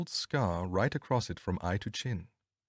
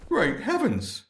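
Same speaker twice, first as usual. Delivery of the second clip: high startled exclamation; cried out; 12 semitones higher, slower than usual. A narrator's reading. Inspector recognising the missing man, high startled cry. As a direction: gasp it out in shock. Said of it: "cried the inspector".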